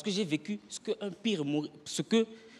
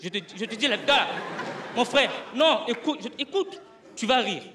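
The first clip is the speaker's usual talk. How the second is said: pleading with the crowd; 6 semitones higher, 3 dB louder than usual. Retold or imitated. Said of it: "j'étais là à supplier le public".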